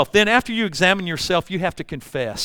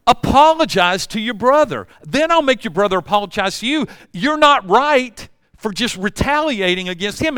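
firm scolding; 6 semitones higher, 5 dB louder than usual. Retold or imitated. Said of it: "He's saying".

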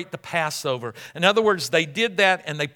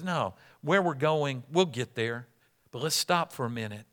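soft, low and unhurried; calm reassurance; 4 semitones lower, 7 dB quieter than usual. Acted. Jesus calmly answers hurrying disciples.

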